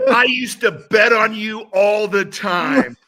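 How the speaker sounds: slow, high, loud mock shout; imitating a shout. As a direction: drag out each word, yelling with glee. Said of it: "And then he goes".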